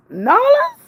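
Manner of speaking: "New Orleans" as a high, drawn-up "Nawlins?"; New Orleans accent. Retold or imitated. Streamer showing chat the local accent, New Orleans "Nawlins".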